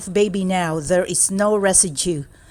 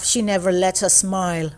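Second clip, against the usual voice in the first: usual speaking voice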